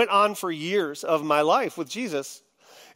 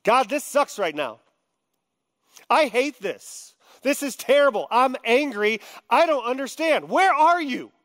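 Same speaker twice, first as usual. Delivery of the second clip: higher, raw frustrated outburst; venting to God; 7 semitones higher than usual. Shown as a demonstration. Believer venting his anger and confusion to God: heated cry. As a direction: unload it all on God, pitch climbing. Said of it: "What does casting all your anxiety on God sound like?".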